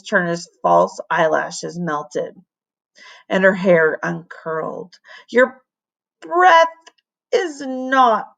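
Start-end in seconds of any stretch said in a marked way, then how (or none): 5.3-8.3 slow, strained croak; a hint of a croaking old voice; croaking librarian. A narrator's reading. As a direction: croak it out as if choking. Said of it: "she croaked".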